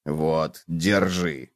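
low, soft, matter-of-fact bug; low quiet cockroach voice; obliging cockroach. A narrator's reading. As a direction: offer it quietly, pitched low. Cockroach hands over a tooth.

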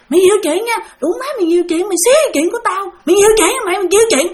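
high, insistent mock tirade; acted-out scolding, piling up accusations; retold cursing. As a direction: imitate a man hurling abuse. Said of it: "ổng chửi Thi nha".

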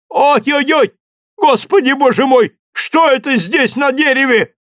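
high frightened shout; startled fright. Acted. Servant in the tree crying out in fright at a find. Jupiter startled finding skull.

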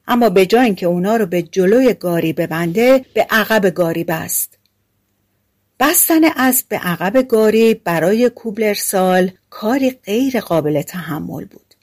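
usual speaking voice

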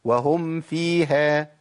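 nasal hum held on the mim before fa; slow recited ghunnah, audible hum; clear ghunnah. Shown as a demonstration. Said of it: "الغنة آه باينة فيها".

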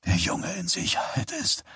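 high, pressed and soft; a tight, high murmur from the trance; strained. A narrator's reading. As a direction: squeeze it out in a trance. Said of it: "sagte Johnny gepresst".

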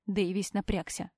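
ordinary delivery

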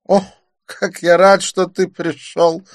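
high, loud, delighted exclamation; exclaiming Didier. A narrator's reading. Narrator voices Didier greeting his friend, delighted. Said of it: "воскликнул Дидье".

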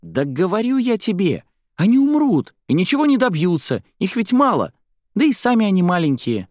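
put-on very high, loud little-girl voice; high-pitched, heated child's chatter; loud arguing child. A narrator's reading. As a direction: argue insistently like an excited kid. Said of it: "заговорили ещё громче".

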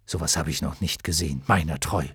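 low, quick character voice; astonished knight. A narrator's reading. Hykryon marvels at the shattered armour, low astonished voice.

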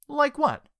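high, innocent mock question; playing dumb. A narrator's reading. Richard playing dumb to Jellyfish, high innocent question. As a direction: ask it all innocence.

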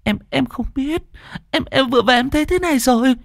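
high, fast, stammering explanation; frightened rush. Acted. Hằng telling Dung about the nightmares, frightened. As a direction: pour it out in fear. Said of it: "Hằng kinh hãi".

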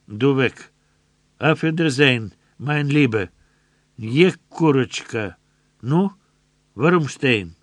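a soldier sneering goodbye; gloating German soldier. A narrator's reading. Soldier sending Rufyna off, loud and gloating.

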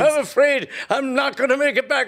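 high, hammed-up sobbing voice; mock tearful farewell. Stricken comrade bids the boys goodbye, mock tearful. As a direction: wail it like a doomed soldier in a melodrama.

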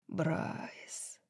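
soft, breathed name; hushed, voiceless murmur; whispered. A narrator's reading. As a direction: whisper the name tenderly to a lover. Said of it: "шептал он".

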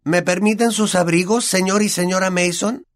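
a spoiled boy playing the polite host; Dudley's voice. A narrator's reading. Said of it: "la voz de Dudley".

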